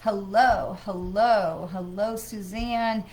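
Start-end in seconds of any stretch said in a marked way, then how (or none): none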